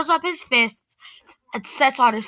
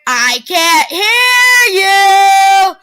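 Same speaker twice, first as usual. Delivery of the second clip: very slow, higher and louder taunt; mocking sing-song; 7 semitones higher, 7 dB louder, much slower than usual. A narrator's reading. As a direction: stretch it out to mock her. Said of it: "the gangbanger says".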